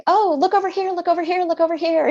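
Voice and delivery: high, loud singsong distraction; distracting parent. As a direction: chirp it up to pull attention away. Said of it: "often we're like".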